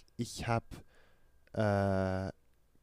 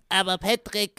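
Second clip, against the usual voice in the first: cartoon character voice; 9 semitones higher, 7 dB louder than usual. SpongeBob scene reply.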